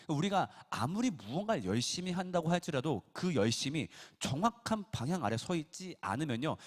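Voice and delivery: ordinary talk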